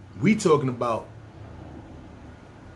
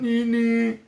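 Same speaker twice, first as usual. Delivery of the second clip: imitated bump sound; 8 semitones higher than usual.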